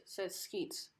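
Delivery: usual speaking voice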